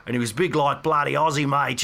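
normal talking voice

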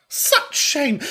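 high-pitched mock horror; theatrical shaming; mock outrage. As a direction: exclaim it high, scandalised in jest. Mock outcry at hidden veganism.

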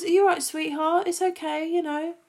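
lightly voiced Doctor reassuring a stranger; imitating Ten. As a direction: coo it like the Doctor soothing someone. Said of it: "he's like".

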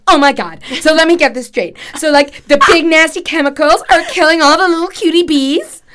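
high, loud, hammed-up gushing host; [name]. Host reacting to bee experts, hammed-up impression. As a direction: overdo a dramatic accent, loud and high. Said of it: "you saying it to me in this dreadful accent".

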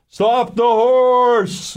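a high, drawn-out shout from nowhere; slow, high ghostly yell of warning; urgent shout. Retold or imitated. Disembodied voice warns of cliff.

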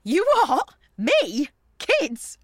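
loud, high startled questions; incredulous. Acted. Mate reacting to being taken for a mum, incredulous. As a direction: blurt it out, taken aback. Taken aback at having children.

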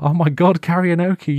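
high, loud shocked cry; karaoke! cried out high; shocked exclaim. Retold or imitated. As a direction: blurt it out in disbelief. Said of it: "I was like".

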